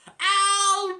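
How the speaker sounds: very high, loud sung-out yell; Dave shouting Alvin. Retold or imitated. Dave yelling for Alvin from the taxi, very high and loud.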